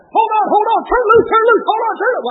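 high, loud contradictory shouts; mock shouting. Church folk shouting mixed orders at a seeker. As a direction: bark conflicting orders at the seeker. Mimicking confusing Pentecostal idioms.

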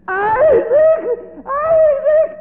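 very high, loud, crying calls; a mother losing her son; mother sobbing for her son. Acted. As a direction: wail his name through tears.

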